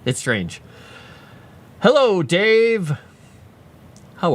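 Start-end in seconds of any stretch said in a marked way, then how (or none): 1.8-4.3 high, loud, stretched-out hello; drawn-out greeting. Real, not acted. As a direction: sing out the hello, slowly and high. Calls out greeting to viewer Dave.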